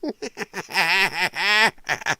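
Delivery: long run of helpless chuckles; rolling burst of laughter; real laughter. Real, not acted. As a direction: crack up for a couple of seconds. Cracks up at the clip.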